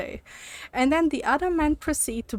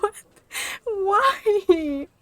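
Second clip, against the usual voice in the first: mock crying; 9 semitones higher than usual. Retold reaction to the ending.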